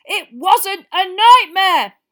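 slow, high, loud yell. Acted. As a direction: shout it out, dragging each word. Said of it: "Ron yelled".

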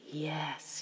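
a soft, hushed yes; quiet. A narrator's reading. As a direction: answer very quietly, under the breath. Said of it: "he said quietly".

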